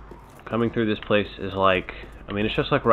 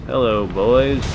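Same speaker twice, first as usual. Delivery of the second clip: taunting greeting; 4 semitones higher, 9 dB louder, much slower than usual. Real, not acted. Taunting enemies.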